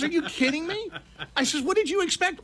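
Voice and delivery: high-pitched incredulous questioning; retold exasperation.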